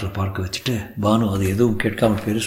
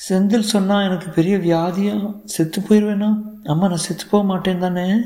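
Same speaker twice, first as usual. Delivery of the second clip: put-on high-pitched little boy, more curious than scared; curious child; 10 semitones higher than usual. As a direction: ask like a doubtful, inquisitive child. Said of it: "குரலில் பயத்தை விட ஆர்வம் இருந்தது". (ஆர்வமான சிறுவன் குரல்)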